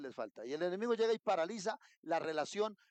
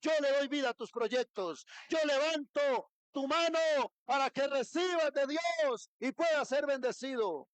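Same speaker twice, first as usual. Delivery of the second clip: voice of God; 8 semitones higher, 4 dB louder than usual. Acted. Preacher voices God's promise.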